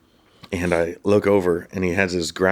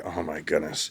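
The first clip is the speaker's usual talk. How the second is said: much softer, deflated groan; 2 semitones lower, 9 dB quieter than usual. Retold or imitated. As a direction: morale sinking, soft. Group hears extra hike.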